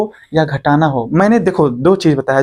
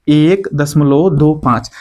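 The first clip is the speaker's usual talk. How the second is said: each digit read out slowly, one by one; slow reading demo; 2 semitones lower, much slower than usual. Shown as a demonstration. Teacher shows students how to read decimals, slowly. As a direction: spell out एक दशमलव दो पाँच slowly, digit by digit. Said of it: "इसको पढ़ेंगे".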